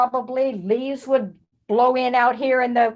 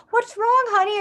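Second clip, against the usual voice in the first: high, gentle parent's question; worried parent; 11 semitones higher, 4 dB louder than usual. Acted. Parents asking their crying child, high and gentle. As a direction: coax it like a worried mom.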